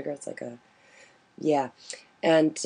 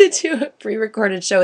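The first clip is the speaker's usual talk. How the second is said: louder, higher, laughing through it; laughing correction; 7 semitones higher, 7 dB louder than usual. Real, not acted. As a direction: repeat it amused after being corrected, pitched up. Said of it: "Show is live".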